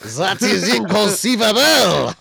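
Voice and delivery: Princess Bride quote. Acted. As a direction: ham up the outraged schemer's catchphrase. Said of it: "The Princess Bride".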